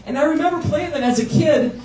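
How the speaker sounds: high, laughing, slowing right down; excited wonder. Real, not acted. Nostalgic wonder, much higher.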